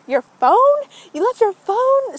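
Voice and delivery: high, loud disbelief; imitated freaking-out mom. Mom thinking the phone is lost, acted freak-out. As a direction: shriek the questions as an appalled mom. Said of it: "she starts freaking out".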